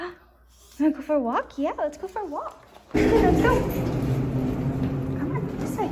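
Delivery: gasp, then high, eager pet talk; excited talking to a dog. Real, not acted. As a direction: hype the puppy up for a walk. Excited walk offer to dog.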